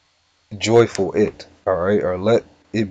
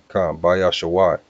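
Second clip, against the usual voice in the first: ordinary delivery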